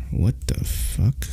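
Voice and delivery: whispered shock; breathy startled whisper. Real, not acted. Reacting to a strange video.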